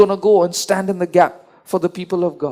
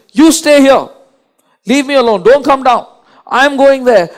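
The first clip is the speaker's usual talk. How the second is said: higher and louder, firm orders; angry God; 5 semitones higher, 5 dB louder than usual. Retold or imitated. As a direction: bark the orders as a furious God. God telling Moses to stay.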